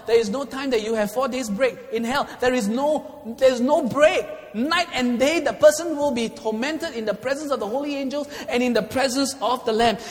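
high, loud hellfire preaching; fiery preaching. Real, not acted. Preacher warning the congregation about hell, high and loud. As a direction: preach it with full force.

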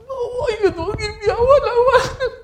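very high, loud tantrum wail; hammed-up desperate toddler squeal; screaming child. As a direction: imitate a child shrieking to get their way. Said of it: "That's why you're screaming and shouting".

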